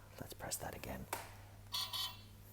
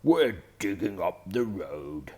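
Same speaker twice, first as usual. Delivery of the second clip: loud bull's bellow; 3 semitones higher, 10 dB louder than usual. A narrator's reading. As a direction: call it out like a big bull. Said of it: "bellows Mister Bull".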